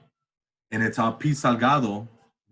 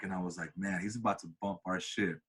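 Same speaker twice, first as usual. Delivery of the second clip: hushed; 4 semitones lower, 8 dB quieter than usual. Retold or imitated. Hushed anticipation.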